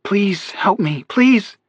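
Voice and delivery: high, slow desperate plea; begging. A narrator's reading. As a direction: beg for help. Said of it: "Maggie begged".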